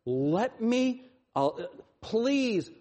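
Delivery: high, halting plea; pleading. Retold or imitated. David asking the Lord to free him, acted pleading. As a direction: imitate an earnest beg in prayer.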